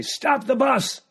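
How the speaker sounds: high-pitched cry to halt the driver; imitated urgent call. Aunt calls to the driver on the family tour, high shout.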